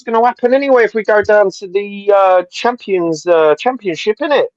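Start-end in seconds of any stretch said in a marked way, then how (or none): none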